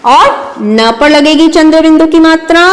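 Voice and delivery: ordinary delivery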